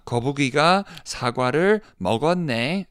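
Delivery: gasp, then high, quick Korean wonder; acted exclamation. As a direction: point it out excitedly to a child. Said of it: "I could add the words in".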